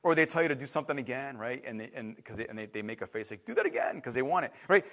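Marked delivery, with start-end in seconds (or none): 3.4-4.0 put-on high baby voice. Baby asks for more.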